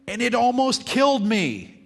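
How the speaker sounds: reading a copywriter's bold punchline with full energy; bold headline read out. Shown as a demonstration. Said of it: "in big, bold letters he'd put".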